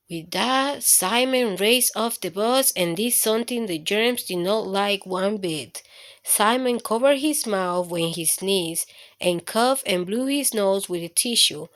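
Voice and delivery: ordinary delivery, nothing marked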